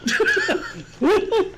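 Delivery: high voice breaking into laughter; laughing. Real, not acted. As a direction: crack up mid-sentence. Laughs while joking.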